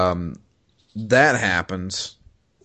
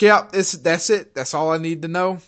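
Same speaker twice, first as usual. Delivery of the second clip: a touch of a raised, higher ruling voice; furious decree; 6 semitones higher than usual. Retold or imitated. Knight Commander ordering all mages killed, furious. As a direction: proclaim the death order in rage. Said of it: "she's like".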